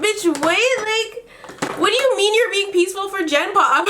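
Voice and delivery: high-pitched incredulous question; mock-baffled, pitched well up; retold disbelief. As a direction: react as if you can't believe it. Retells her disbelief at the phrase.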